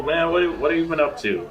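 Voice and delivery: usual speaking voice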